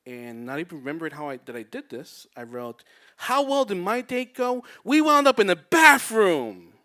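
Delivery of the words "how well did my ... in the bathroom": loud, high tipsy crowing; boastful post. Acted. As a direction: announce it proudly, stretching the last words out. Reads out his tipsy boastful post.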